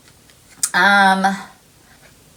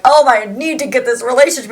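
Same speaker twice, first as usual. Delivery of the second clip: higher, louder, rushed mock urgency; mock overdramatic; 5 semitones higher, 5 dB louder, faster than usual. Reader mimicking a person thought to be desperate, mock overdramatic. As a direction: ham up a frantic plea. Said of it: "really being over dramatic about it".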